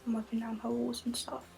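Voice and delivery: soft unvoiced whisper throughout. Real, not acted. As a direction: keep it hushed, barely voiced. Quiet whispered chat at home.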